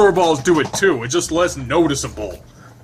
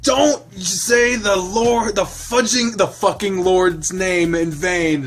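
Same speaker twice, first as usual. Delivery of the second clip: high, slow, hammed-up scolding; mock the prude snapping from the front seat; front-seat passenger scolding Steve in a high mock outrage; outraged scold; 6 semitones higher, 4 dB louder, much slower than usual. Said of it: "it's like".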